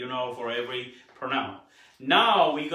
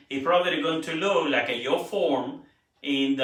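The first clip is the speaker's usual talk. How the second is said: ordinary talk, nothing marked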